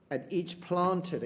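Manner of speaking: slow, deliberate verse reading; poem reading. Shown as a demonstration. Teacher rereading the line slowly for the class. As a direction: draw out planted and let it fall. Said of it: "quoting the poem's lines".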